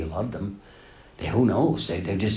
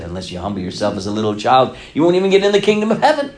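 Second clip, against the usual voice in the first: Jesus quoted forcefully; 6 dB louder, much faster than usual. Retold or imitated. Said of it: "Jesus actually said".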